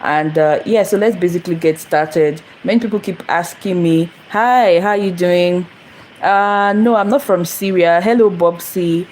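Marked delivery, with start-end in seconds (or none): none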